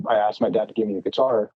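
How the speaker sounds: ordinary talk, nothing marked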